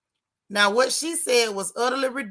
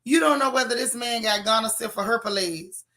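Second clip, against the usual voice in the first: ordinary talk, nothing marked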